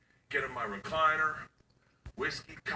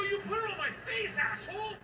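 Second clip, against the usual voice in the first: high indignant yelp; outraged. Retold or imitated. Dad yelling at daughter who infected him. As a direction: squeal in disgust.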